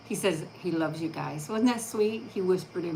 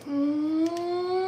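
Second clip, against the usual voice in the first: high kissy dog voice; voicing the dog; 11 semitones higher than usual. Owner voices her dog sending a kiss, high and squeaky. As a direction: make a high smooch for the pup.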